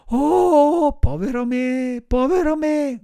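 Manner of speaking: high, loud wail of self-pity; theatrical puppet lament; despairing cry. A narrator's reading. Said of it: "gridò Pinocchio".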